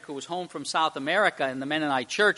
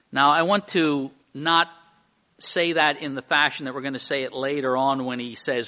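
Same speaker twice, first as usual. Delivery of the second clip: ordinary delivery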